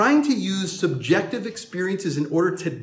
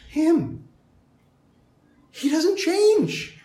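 preaching climax; 7 semitones higher than usual. Acted. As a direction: lift it to the climax. Climax on God not changing.

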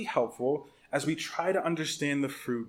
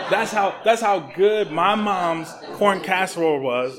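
laughing through it, louder and higher; 4 semitones higher, 7 dB louder, slower than usual. Real, not acted. Pastor laughing to the congregation about mom's casserole, laughing. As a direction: crack up while saying it.

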